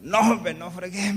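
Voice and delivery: loud, high-pitched refusal; acted-out exclamation of protest; alarmed protest. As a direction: imitate a cousin objecting loudly.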